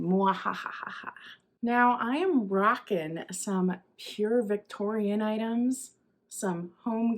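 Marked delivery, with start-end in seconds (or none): 0.0-1.1 whispered mad scientist cackle; breathy villain cackle; evil laugh. Pretend. Spooky season evil laugh.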